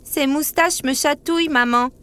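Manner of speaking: put-on high little child's voice, tickled; Zip exclaiming. A narrator's reading. Little cup tells his mother he's tickled, exclaiming. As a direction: pipe up like a small boy. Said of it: "s'exclama Zip".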